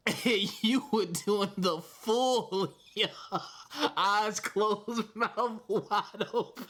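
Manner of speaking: high giggling description; teasing co-host about her yawn, laughing. Real, not acted. Mocking co-host's yawn, laughing.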